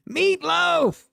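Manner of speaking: put-on high, loud kid voice wailing; mock child wail. Trader hamming up a kid's meatloaf wail. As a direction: holler it like a kid at dinner.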